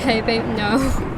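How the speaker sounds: loud, slow call across the room. Real, not acted. Player calls out to interrupting partner, loud and slow. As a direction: stop her with a loud, drawn-out call.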